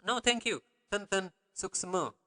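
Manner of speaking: light, courteous acted "no thanks"; mock very polite refusal. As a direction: demonstrate declining an offer gently.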